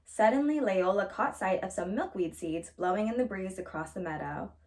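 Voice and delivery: usual speaking voice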